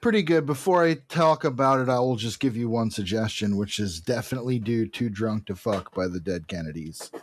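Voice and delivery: usual speaking voice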